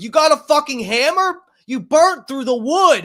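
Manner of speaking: high, loud exasperated shout; angry yell. Retold or imitated. Homeowner berating the man who burnt his porch, angry shout. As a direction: berate him for the ruined wood. Angry retelling yelling at arsonist.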